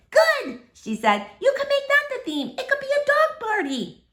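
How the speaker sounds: put-on high little girl voice. Sweetie Pie asking about the party, high and little. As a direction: chirp it like a small child.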